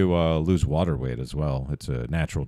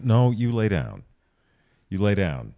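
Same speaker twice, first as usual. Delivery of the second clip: higher, raised firm order; firm dog command; 5 semitones higher, 3 dB louder than usual. Real, not acted. Scolding the dog mid-stream.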